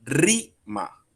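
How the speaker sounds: "rima" broken in two, RI high and punched, short ma; stress on RI. Shown as a demonstration. Teacher shows the force is in the I, stress on RI in "rima". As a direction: hit RI hard in "rima", pause, then ma. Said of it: "La fuerza de la palabra está en la I".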